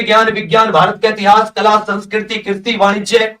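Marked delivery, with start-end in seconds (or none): none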